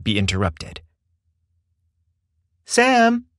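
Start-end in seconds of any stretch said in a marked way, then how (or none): 2.6-3.3 high sing-out of a calling mother; pleasant, firm, high-pitched call; pleasant but firm call. A narrator's reading. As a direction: call upstairs-to-downstairs like a mom. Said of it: "A pleasant but firm voice called from the top of the stairs".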